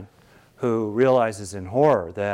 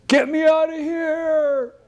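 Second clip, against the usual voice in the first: much higher, loud, slow cry for help from the pit; desperate shout; 5 dB louder, slower than usual. Acted. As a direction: yell up out of the hole, stretched and pleading. Trapped hunter calls for help.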